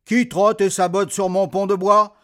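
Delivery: loud menacing troll voice. Acted. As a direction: boom it at the intruder. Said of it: "dit le troll".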